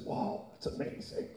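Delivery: breathless whisper of awe; awed whisper to God; whispered wonder. Acted. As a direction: whisper it like a dazzled angel. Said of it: "And the angel said".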